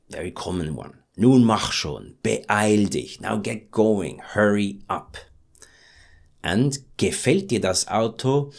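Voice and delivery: ordinary delivery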